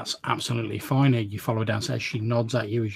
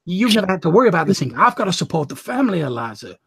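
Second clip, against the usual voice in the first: rising higher and faster, building to urgent; desperate pleading; 5 semitones higher than usual. Acted. Edgar explaining to his sister why he took the work, building desperate plea. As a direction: pour it out, justifying himself.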